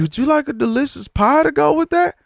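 high, sugary mock cashier voice; overly cheerful imitation of the server; mock chipper cashier. Mocking the cashier's cheerful upsell, high chipper mock voice. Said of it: "chipper as fuck".

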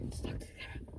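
unvoiced whispered aside. Real, not acted. Kid prompting the toy to talk to the camera, whispered. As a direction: breathe it as a hidden prompt.

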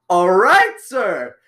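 high, loud, strained yell of "All right, sir!"; stressed scream. Retold or imitated. Retelling a parting shot at the teacher while walking away, stressed high scream. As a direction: belt it out as stressed as you can. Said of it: "the most, like, stressed way I could".

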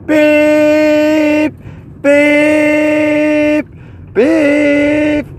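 high, loud, drawn-out beeping; shrill electronic beep impression, held long; imitated microwave beep. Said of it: "It's like".

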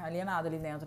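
ordinary talk

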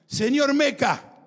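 high, loud, drawn-out call; acted-out barked summons; imitated soldiers' shout. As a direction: bellow the name like an armed guard. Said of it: "y gritan".

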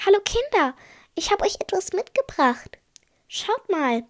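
high, lilting mum voice; high-pitched mum calling the kids over; mother greeting kids. A narrator's reading. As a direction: sing it out cheerily high to the children.

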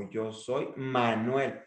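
MANUEL set apart and stretched slowly; slow right down to land on "Manuel"; stress on MANUEL. Shown as a demonstration. Coach demonstrating key-word emphasis, "Manuel" drawn out. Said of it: "subir el tono en una pala".

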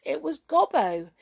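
plain reading voice

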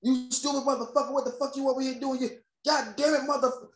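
high-pitched mock parent tirade; imitated cursing-out. Host mimicking parents cursing their kids out, mock angry rant. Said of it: "like, curse their kids out".

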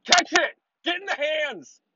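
high, hammed-up fan shouting at a pass; mock football fan yell. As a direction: mimic a dumb fan yelling at the ball. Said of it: "Throw a football pass".

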